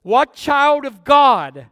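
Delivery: normal talking voice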